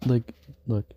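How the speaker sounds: ordinary talk, nothing marked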